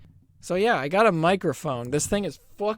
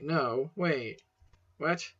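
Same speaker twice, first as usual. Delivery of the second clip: ordinary delivery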